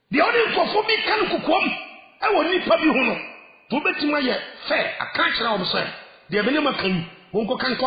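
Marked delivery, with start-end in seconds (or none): 0.1-3.2 shouted repeat. Acted. Preacher repeats line, builds up.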